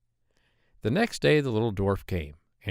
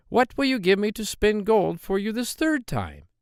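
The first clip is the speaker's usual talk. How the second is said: higher, squeaky voice of a hobbling little man; droll little man; 11 semitones higher, 3 dB louder than usual. Acted. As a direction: pitch him up high and sly. Dwarf bargains a third time.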